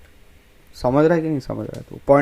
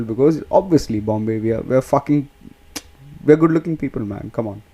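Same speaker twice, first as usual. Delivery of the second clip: ordinary talk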